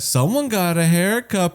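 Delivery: high, drawn-out singsong heckle; taunting call-out. Retold or imitated. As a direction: stretch it out like teasing a schoolmate. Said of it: "People always say that shit".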